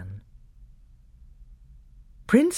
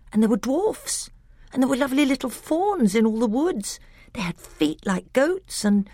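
put-on higher, bright eager boy voice; a child excitedly telling tales; eager little boy; 10 semitones higher than usual. A narrator's reading. Caspian tells his uncle of old Narnia, eager and boyish.

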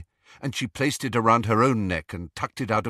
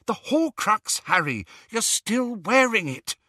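urgent excited; 12 semitones higher than usual. A narrator's reading.

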